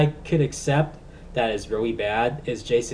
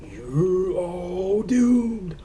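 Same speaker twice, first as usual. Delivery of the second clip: very slow, higher ominous warning; slow ominous raspy drawl; 7 semitones higher, much slower than usual. Retold or imitated. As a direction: draw out the doom like a madman. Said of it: "has to be Crazy Ralph".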